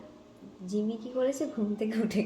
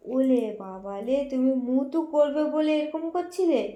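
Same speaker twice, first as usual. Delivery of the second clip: put-on 1 baby talk to the cat; 4 semitones higher, 6 dB louder than usual.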